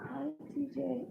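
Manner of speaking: put-on high, soft greeting to a child; retold tearful greeting. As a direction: greet your child softly through imitated tears. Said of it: "crying and".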